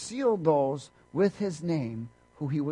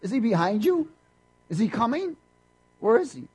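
mock-puzzled Philip, a bit higher; Philip searching; 7 semitones higher, 4 dB louder than usual. Philip looking behind Jesus for the Father, mock puzzled. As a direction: peer around and ask, lightly acted. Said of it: "He's looking behind Jesus".

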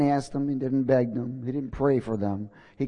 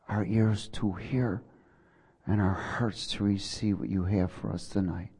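prayer; 5 semitones lower than usual. Acted. Pastor leading the congregation in prayer, hushed and fading. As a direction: pray them into the throne room, fading down.

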